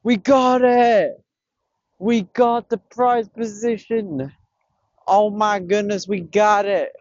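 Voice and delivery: high, drawn-out victory cry; triumphant. Real, not acted. Angler celebrating his prize position, triumphant. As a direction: savour the win in long, high words.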